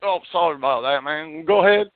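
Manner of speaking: usual speaking voice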